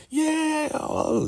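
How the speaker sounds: very high, thin cheer; mock crowd scream. Excited followers as the Highest comes in, mock screaming. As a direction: imitate screaming fans without the volume. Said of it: "Everybody's excited and screaming".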